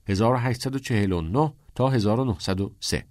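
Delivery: usual speaking voice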